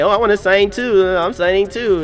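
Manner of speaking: high, loud, hammed-up eager voice; mock eager. Mimicking wedding guests who all want to sign, hammed-up eager. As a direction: imitate the crowd rushing to sign in.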